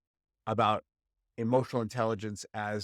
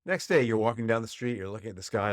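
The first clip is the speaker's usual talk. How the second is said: normal talking voice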